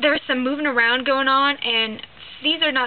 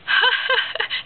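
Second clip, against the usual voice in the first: laugh; 12 semitones higher, 3 dB louder than usual. Retold or imitated. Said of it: "that's my impersonation".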